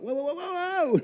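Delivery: reliving being overwhelmed, high and excited; overwhelmed whoa. Retold or imitated. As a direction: let the whoas fly up, overwhelmed. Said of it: "I was like".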